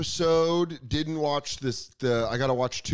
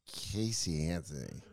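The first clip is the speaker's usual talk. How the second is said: put-on drunk bar guy; 8 semitones lower, 9 dB quieter than usual. Drunk guy at a Florida bar to a woman, low mumbled. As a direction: lower and softer, a hint of a hammered barfly.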